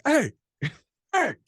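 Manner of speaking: high-pitched mock hollering; imitated calling out. Cabbie screeching up beside stranded travellers, imitated high call. Retold cabbie hailing the group.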